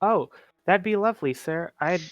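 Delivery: ordinary delivery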